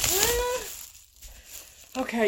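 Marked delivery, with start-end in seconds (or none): 0.0-0.6 surprised. Retold or imitated. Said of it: "I was like".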